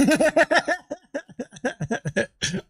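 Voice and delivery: laughing high at himself; high breathy laugh. Real, not acted. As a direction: burst out laughing, embarrassed. Laughs at own attempt.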